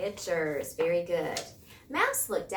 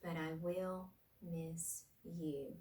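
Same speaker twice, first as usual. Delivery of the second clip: tender; 3 semitones lower, 10 dB quieter, slower than usual. A narrator's reading. Bear's tender farewell.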